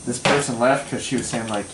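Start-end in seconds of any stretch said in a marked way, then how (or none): none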